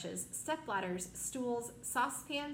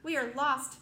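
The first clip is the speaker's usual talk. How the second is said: frightened cry, louder; 4 semitones higher, 7 dB louder than usual. Acted. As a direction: wail that all is lost. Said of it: "Miss Spider cried".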